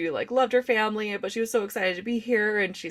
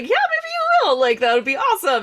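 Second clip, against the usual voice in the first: much higher and louder, cheering her on; eager encouragement; 12 semitones higher, 7 dB louder than usual. Retold or imitated. Cheering on the young waitress's dream of managing, much higher and louder. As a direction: gush with excitement for her future.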